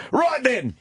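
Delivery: hammed-up English copper; British bobby accent. Bobby answering the Toledo tourist in British accent. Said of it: "bobby reply to tourists".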